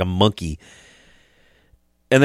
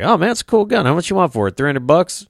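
higher-pitched, mock impressed haggling; imitating an eager buyer; 9 semitones higher than usual. Gun buyer haggling at a gas station, imitated eagerly. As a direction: act out a keen customer, pitched up.

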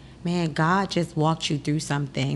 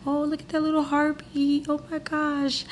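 put-on higher, soft, fond coos at the baby on screen; joyful cooing; 10 semitones higher, 3 dB quieter than usual. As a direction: coo over the ultrasound like a proud new mom. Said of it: "I was in joy".

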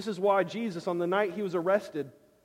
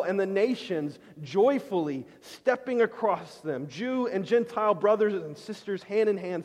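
louder, impassioned preaching; 3 semitones higher, 4 dB louder than usual. Acted. Preacher asking the congregation to imagine the wall destroyed, louder and impassioned. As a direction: paint the picture for them with passion. Preacher painting joyful vision.

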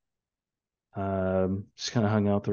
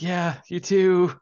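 high, slow, loud forced pleasantry; exaggerated polite reply, pitched way up; mock cheerful; 10 semitones higher, 5 dB louder, slower than usual. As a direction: act out brightly returning a farewell under strain. Parent replies while hauling screaming toddler.

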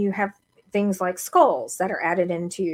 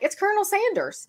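Colonel Sanders-like character; 11 semitones higher than usual. Retold or imitated. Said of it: "this character that he gets into".

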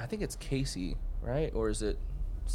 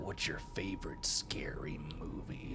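lower, softer whispered taunt; killer whisper; 6 semitones lower, 10 dB quieter than usual. Retold or imitated. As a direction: breathe the question menacingly. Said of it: "Where he's like".

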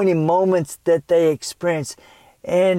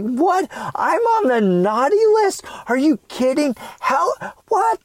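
high, rising cries of disbelief; a little boy who can't believe Santa skipped him; stunned disbelief; 9 semitones higher than usual. Retold or imitated. Boy on Christmas morning to his family, high stunned disbelief. Boy thinks he's on naughty list.

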